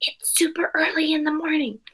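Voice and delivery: whispered night-time dad; dad whispering to his daughter in the dark. Acted. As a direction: hush it like a dad at two thirty am. Sleepy dad talking at night.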